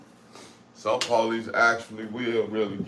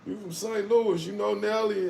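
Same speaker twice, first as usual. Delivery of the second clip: curious strangers asking; 9 semitones higher than usual. Retold or imitated. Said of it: "They like".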